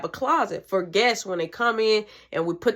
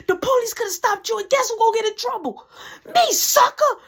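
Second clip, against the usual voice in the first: mom scolding; 8 semitones higher than usual. Retold or imitated. Mom chewing out her son over the late night, an escalating shrill rant. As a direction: play the mom tearing into her son, building to a shriek.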